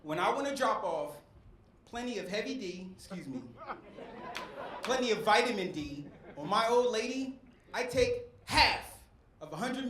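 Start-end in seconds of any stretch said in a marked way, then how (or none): none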